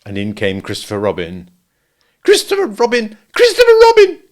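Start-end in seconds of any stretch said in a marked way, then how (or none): 2.2-4.2 high call building to a loud squeal; crying out. A narrator's reading. Piglet appealing to Christopher Robin at the door, crying out. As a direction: call the name twice, more urgently the second time. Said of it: "cried Ki-, Piglet".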